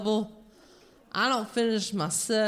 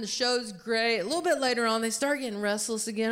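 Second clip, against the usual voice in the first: ordinary talk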